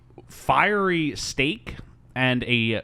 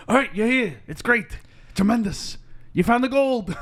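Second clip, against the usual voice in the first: high, punchy talk-show host impression; Regis; 10 semitones higher than usual. Host congratulating the gold finder, high hammed-up enthusiasm. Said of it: "Regis is like".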